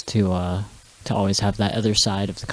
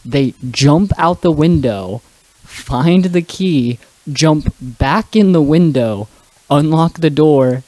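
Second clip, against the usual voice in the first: slow, loud, raised step-by-step telling; emphatic storytelling; 4 semitones higher, 5 dB louder, slower than usual. Real, not acted. Telling friends the climax of the gag, slow and emphatic. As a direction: punch out each action, drawing it out.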